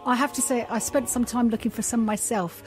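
ordinary delivery

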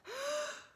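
high sharp intake of breath; big breath in. Acted. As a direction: gasp in like before a plunge. Lucy takes a deep breath.

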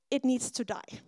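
ordinary talk

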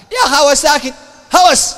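high-pitched, exclaimed dismissal; exasperated outburst. Real, not acted. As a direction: burst out with it, pitch jumping up. Preacher dismisses it as mere frenzy.

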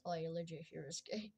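hushed, cheeky whisper; whispering the punchline; whispered joke. Real, not acted. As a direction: whisper it with a laugh.

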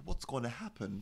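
normal talking voice

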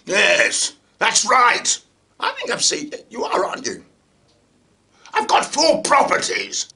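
hammed-up politician with pompous laughs; chortling mock politician; Boris. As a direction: play a self-satisfied politician at an audition. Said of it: "Are you Boris?".

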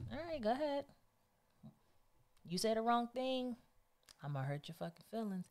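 soft, high, drawn-back dare; quiet threat that starts high and drops; calmly daring them to continue; warning. Acted. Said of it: "I'd just be like".

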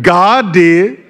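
crowd-working punchline; joking punchline. Acted.

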